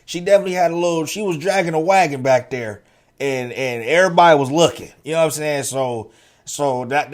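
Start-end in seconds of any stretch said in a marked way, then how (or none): none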